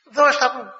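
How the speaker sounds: high, loud insistence; high, loud command; insistent order. Retold or imitated. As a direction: press him to give it away. Melania's answer from the boat.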